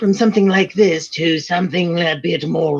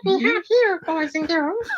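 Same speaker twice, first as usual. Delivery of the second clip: very high, squeaky demonic puppet voice; creepy high puppet greeting the kids; Satan Elmo voice. Acted. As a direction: squeeze the throat into a creepy Elmo. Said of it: "I sound like Satan Elmo".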